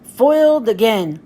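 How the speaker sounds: a thwarted cartoon pirate; Pete voice. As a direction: Pete grumbling at his failed trick. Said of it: "says Space Pirate Pete".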